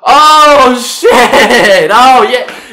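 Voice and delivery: high, loud whine bursting into a laughing realisation; loud drunken protest. Acted. Drunk telling roommate, loud protest turning to realisation. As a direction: protest loudly, then whoop as it clicks.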